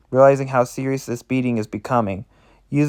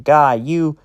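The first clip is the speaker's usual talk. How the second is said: pained, raised-pitch curse; hurt and cursing, pitch lifting; gasping in pain; 4 semitones higher, 3 dB louder, much slower than usual. A narrator's reading. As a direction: curse through a blow to the stomach. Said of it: "She gasps in pain".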